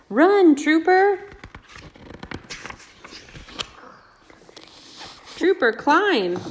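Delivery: high, slow urgent call; excited urging. Acted. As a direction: cheer the dog on. Urging dog in story.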